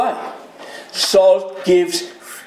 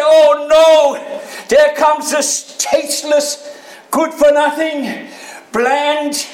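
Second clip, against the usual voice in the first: much higher, louder mock scorn, a bit fast; frowning disgust; 9 semitones higher, 4 dB louder, slower than usual. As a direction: sneer at a tasteless person. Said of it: "frown and say".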